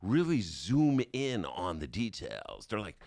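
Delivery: ordinary delivery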